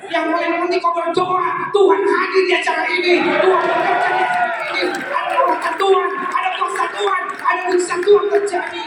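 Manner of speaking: rising, forceful preacher's cry, hammering the refrain; fervent preaching. Acted. Preacher rousing the congregation about God's power, fervent loud preaching. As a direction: preach it out loud with mounting fervour.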